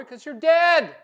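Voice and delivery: loud, blunt punchline; shouted punchline. Real, not acted. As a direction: belt out the last word. Punchline about life insurance payout.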